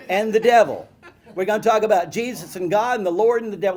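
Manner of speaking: loud, high mock-sermon roll call; child playing preacher. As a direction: hammer out the names like a pretend preacher. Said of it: "I would preach like that".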